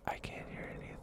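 very soft, voiceless whisper; hushed ASMR voice. Shown as a demonstration. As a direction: whisper it close to the mic. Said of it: "like, whispering".

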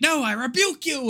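high-swooping hammed-up cry; mock rebuke. Exorcist runs in to stop the rubbing, mock rebuke. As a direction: burst in as a comic exorcist.

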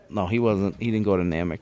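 ordinary delivery

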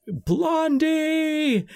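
high, drawn-out mock holler, held back in volume; imitated scream. Co-host acting out Tuco screaming after Blondie as he rides away, mock scream. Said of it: "and he's screaming, like".

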